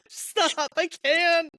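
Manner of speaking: high, laughing wail of protest; overcome wail. Real, not acted. Co-host undone by sad chapters, high overwhelmed wail.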